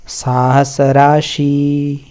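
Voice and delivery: very slow, syllable by syllable साहस राशि; teacher's slow recitation. Shown as a demonstration. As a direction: spell out साहस राशि slowly for students. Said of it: "ये सा जो है ये आधा है".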